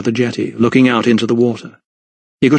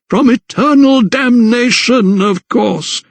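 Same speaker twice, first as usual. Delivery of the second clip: high, stretched-out pronouncement; slow, singsong patronising vicar; condescending zealot; 11 semitones higher, slower than usual. A narrator's reading. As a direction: spell out doom as if to a child. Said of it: "as if he were speaking to a child".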